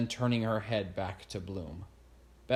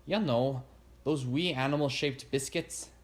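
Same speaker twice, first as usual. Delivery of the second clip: higher-pitched Scottish lilt; thick Scottish accent; 4 semitones higher than usual. A narrator's reading. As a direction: voice a Scottish woman. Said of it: "in a thick Scottish accent".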